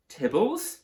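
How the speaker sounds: high, loud squeaked question; a shocked, squeaky yelp of a name; landlady squeaking in horror at the name. A narrator's reading. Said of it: "squeaked Missus Featherstone".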